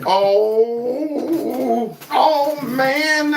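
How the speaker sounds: can't believe what he heard; stunned disbelief. Real, not acted. Reacting in disbelief to clip.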